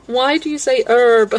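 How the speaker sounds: herb said without the H, slow and loud; incredulous American herb; mock American herb. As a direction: drop the H in herb, American style. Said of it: "Where did the H go?".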